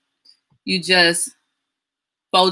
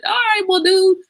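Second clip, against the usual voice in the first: high, louder indignant dude; mock exasperated; 13 semitones higher, 5 dB louder than usual. Retelling telling off someone over fish, exasperated high voice. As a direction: act out the fed-up retort.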